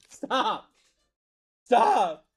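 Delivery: high, escalating bursts; short, loud, high protest; exasperated shouting. Real, not acted. Telling chat to stop asking.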